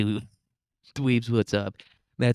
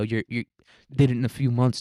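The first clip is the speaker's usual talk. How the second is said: ordinary talk, nothing marked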